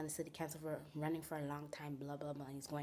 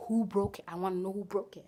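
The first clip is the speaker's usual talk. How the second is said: demanding shout; 2 semitones higher, 8 dB louder than usual. Retold or imitated. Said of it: "So the eighth time, she's like".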